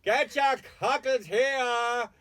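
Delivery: high, loud, drawn-out street cry; street seller's cry. Retold or imitated. Molly Malone crying her wares from the wheelbarrow. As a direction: bellow it like a hawker selling cockles.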